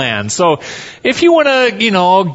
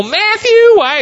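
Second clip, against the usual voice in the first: much higher, nasal country twang calling out; Festus twang impression; 4 dB louder than usual. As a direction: imitate Festus whining through his nose. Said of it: "he kinda talks through his nose".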